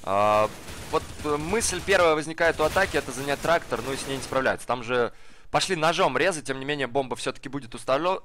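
ordinary talk, nothing marked

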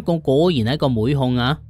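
ordinary delivery, nothing marked

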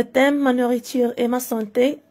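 ordinary talk

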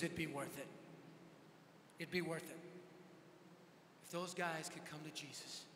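low, sincere retold reply; quietly earnest. Friend answering a scolding about risking his life, quietly earnest.

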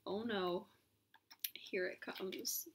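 whispered low foreboding; hushed dread. Acted. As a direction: brace for it quietly. Mock dread.

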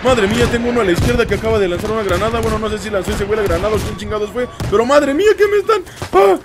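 high, loud panicked yelling; panicked shouting. Real, not acted. Panicking under bombardment.

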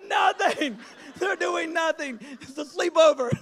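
high, loud frantic denial; shouting over a movie scene in panic; panicked shout. Retold or imitated. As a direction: cover it up in a panic, laughing. Panicked cover-up to nephew.

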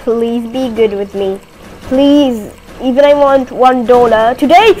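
usual speaking voice

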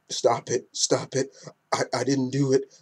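soft, defensive protest; meek denial. Acted. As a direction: play him shrinking from the accusation, quietly. Acted denial by accused Cyclops.

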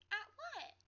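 put-on young girl asking, baffled; confused girl.

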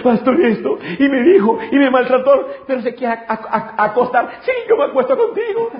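high-pitched mock tearful wail of a complaint; mock crying. A wife complaining to her pastor about her drunk husband, mock crying. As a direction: imitate a weeping wife, hammed up and high. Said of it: "yo llorando".

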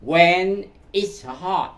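very slow, word by word; slow careful English model reading; slow teaching read. Shown as a demonstration. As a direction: spell the phrase out slowly for learners. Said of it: "bạn gắn vô cái câu là".

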